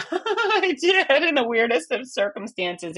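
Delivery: laughing through the words, high and drawn out. Real, not acted. Laughs at her own achievement.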